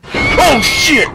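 piercing high, loud shout; terrified shriek. Real, not acted. Player hit by the last scare, shrieking.

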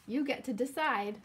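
ordinary delivery

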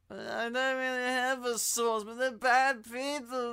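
high, droning mock excuse; slurred, high-pitched mocking voice; mocking Eddie Brock. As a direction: imitate a feeble, petulant excuse-maker. Mocking Eddie's answer to his boss.

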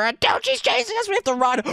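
high-pitched urgent alarm; panicked warning. Real, not acted. Gamer warning a friend of a chase, panicked. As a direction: squeal it as a chase starts.

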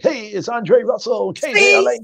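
mock a radio host's on-air greeting; put-on radio voice. Showing Richard the on-air voice he avoids, put-on radio voice. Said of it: "try to talk in my regular voice".

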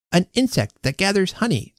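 put-on high-pitched boy reciting an answer; child prodigy. A narrator's reading. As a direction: answer like a quizzed little boy. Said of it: "said the prodigy".

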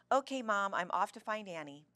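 loud, hurried sign-off; a touch of haste, starting loud; hasty. A narrator's reading. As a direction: toss it out on the way off. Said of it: "Ella said hastily".

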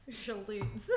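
shallots said through giggles; laughing through it. Real, not acted. Vlogger laughing at her own pronunciation attempt. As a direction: try the word while cracking up. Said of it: "I can't".

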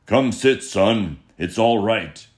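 a hearty father bear soothing his son; big Papa Bear. Acted. Papa Bear calming Baby Bear, loud booming voice. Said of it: "said that huge Papa Bear".

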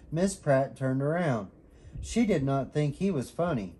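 plain reading voice

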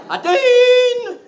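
put-on loud high shout for the girl; calling out. Comedian calls loudly for the absent girl. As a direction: bellow her name across the room.

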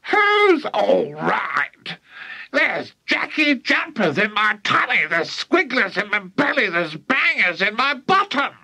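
put-on high old-woman screech; yelling. A narrator's reading. Grandma listing her torments to George, yelling. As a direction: wail indignantly in a high voice. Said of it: "she yelled".

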